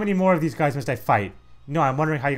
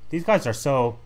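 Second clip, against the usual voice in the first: ordinary talk, nothing marked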